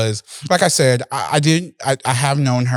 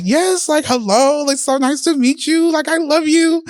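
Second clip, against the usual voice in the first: much higher, gushing fan greeting; starstruck gush; 13 semitones higher than usual. Retold or imitated. A fan meeting [name] for the first time, high starstruck gush. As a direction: squeal it out like meeting your idol.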